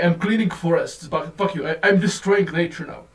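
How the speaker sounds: ordinary delivery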